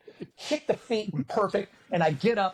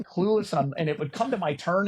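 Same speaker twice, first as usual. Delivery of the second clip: ordinary talk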